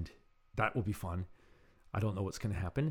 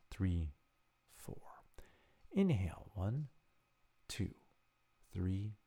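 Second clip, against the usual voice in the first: guided meditation; 2 semitones lower, 5 dB quieter, slower than usual. Real, not acted. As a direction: lead them gently, pausing after each count. Leads a breathing meditation.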